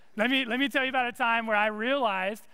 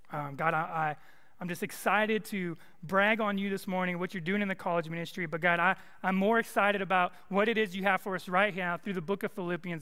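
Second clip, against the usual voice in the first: lower, earnest prayer voice; pastoral prayer; 3 semitones lower than usual. Acted. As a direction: pray over the room, sincere and steady. Leads congregation in prayer.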